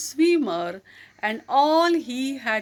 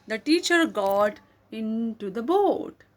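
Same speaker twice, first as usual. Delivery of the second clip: ordinary delivery, nothing marked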